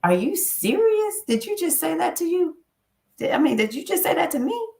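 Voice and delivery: mock outrage at her own words; incredulous self-scolding. Scolding herself in disbelief at what she said. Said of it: "I've been saying to myself".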